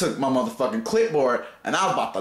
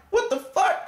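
high, startled what the fuck; jolted high squawk at the bite; shocked outburst. Retold or imitated. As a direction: yelp it out in disbelief. Bitten by the dog, shocked.

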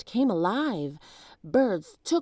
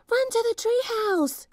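put-on much higher girl's panicked cry; 3 dB louder than usual. A narrator's reading. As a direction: urge him to flee. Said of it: "cried Annie".

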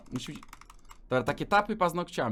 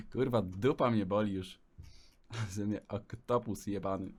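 ordinary talk, nothing marked

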